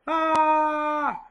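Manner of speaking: high, loud mock scream; a squealing yell of falling downhill; panicked scream. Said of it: "And I'm yelling".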